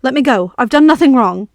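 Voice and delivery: a touch of fear in the plea; frightened boy denying everything, mildly shaky; shaking voice. Acted.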